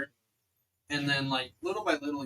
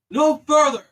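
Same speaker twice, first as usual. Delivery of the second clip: high, very loud guard's shout; booming order to stop an intruder; called-out challenge; 14 semitones higher, 17 dB louder than usual. Acted. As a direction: call out a halt from atop the wall. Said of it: "you hear a voice call out".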